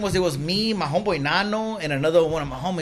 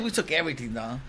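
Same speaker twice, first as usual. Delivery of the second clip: normal talking voice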